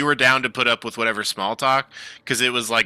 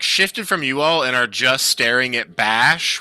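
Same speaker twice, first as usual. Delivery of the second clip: ordinary delivery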